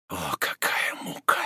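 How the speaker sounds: soft whispered moan; whispered lament. A narrator's reading. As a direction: whisper it in torment. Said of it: "прошептал Роден".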